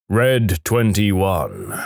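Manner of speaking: very slow, drawn-out pronouncement from above; booming voice. Acted. Voice from above names the bet, slowly booming. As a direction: stretch each word like a mock oracle. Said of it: "booms the voice".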